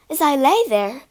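ordinary delivery, nothing marked

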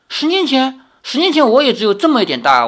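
high, fast astonished denial; bewildered, protesting it can't be; surprised protest. A narrator's reading. Li Xiaoyao denies saving her.

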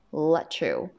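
"let you" joined as "le-chu"; linked pronunciation. Shown as a demonstration. Teacher repeating the linked form for students, run together. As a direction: slide "let" into "you" with a ch sound. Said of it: "所以我们读成什么".

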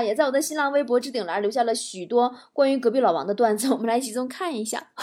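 ordinary talk

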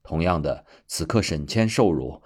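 plain reading voice

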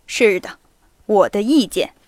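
usual speaking voice

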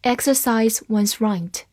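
normal talking voice

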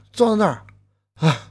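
usual speaking voice

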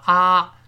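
啊 held long and loud as an open ah; open ah vowel. Shown as a demonstration. Teacher showing the first part of British can't, long open 啊. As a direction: sustain a clear, loud 啊. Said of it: "前面发啊".

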